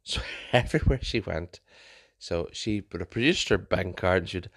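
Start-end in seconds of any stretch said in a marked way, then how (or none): none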